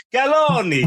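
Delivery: Italian accent, Galloni up high and falling; mock Italian accent. Shown as a demonstration. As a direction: roll it out like an Italian name. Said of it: "I don't want to do an Italian accent".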